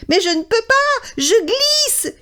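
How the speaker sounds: slipping and scared; mouse crying out. A narrator's reading. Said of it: "crie Souriceau".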